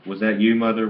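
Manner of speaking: high, loud wailing question; wailed. A narrator's reading. Grandfather frightened for his wife, wailing high and loud. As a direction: wail it out in fright. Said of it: "wailed grandfather".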